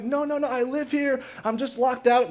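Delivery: high-pitched mock yelled explanation; retold yelled protest. Locked-out tenant to security guard, mock yelling. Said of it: "all of this yelling".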